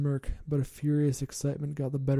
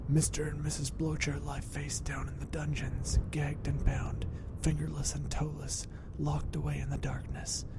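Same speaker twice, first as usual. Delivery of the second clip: rasped, breathy whisper, softer; hoarse whisper; 4 dB quieter than usual. Acted. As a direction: hiss the grim news in a hoarse whisper. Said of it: "she hoarsely whispered".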